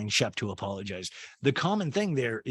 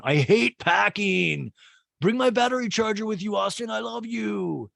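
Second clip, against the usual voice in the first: mock calling out; 10 semitones higher, slower than usual. Craig calls to Austin while packing, exaggerated holler. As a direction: call across the house in a hammed-up high voice.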